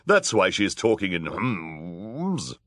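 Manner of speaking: scheming villainess. A narrator's reading. Villainess covering up.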